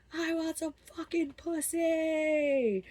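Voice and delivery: high, slow, drawn-out mock yell; imitated crazed demand. Raging boy to thrown friend, imitated crazed yell. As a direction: stretch it out high like a mock howl.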